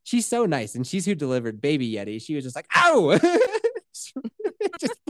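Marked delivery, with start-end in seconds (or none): 2.8-3.2 high, loud imitated "Ow"; mimicked yelp. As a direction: yelp as if suddenly hit. Midwife hit by fluid, retold.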